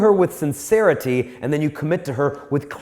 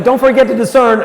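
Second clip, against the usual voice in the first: higher, loud call; calling after him; 7 semitones higher, 8 dB louder than usual. Retold or imitated. Speaker calling after the guy with the gum, loud. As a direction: yell a reminder at his back. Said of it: "I'm like".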